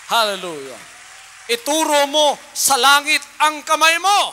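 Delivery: loud call to raise your hands; a rousing preacher's cry; exhorting shout. Real, not acted. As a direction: shout it to the congregation. Preacher exhorts congregation loudly.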